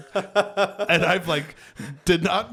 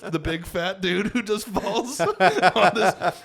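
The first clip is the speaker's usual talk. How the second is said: laughing; 5 semitones higher, 4 dB louder than usual. Real, not acted. Laughing about falling on woman.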